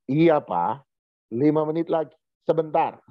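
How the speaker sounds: ordinary talk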